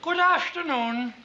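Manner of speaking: slow, put-on Viennese lecturer; fake Viennese accent. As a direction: greet the hall in a drawn-out fake Viennese accent. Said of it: "Viennese will do".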